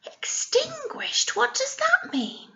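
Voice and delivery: high, hushed pretend puzzlement; mock puzzled. Teacher pretends to puzzle over a hard word, high and hushed. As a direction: wonder aloud as if you don't know.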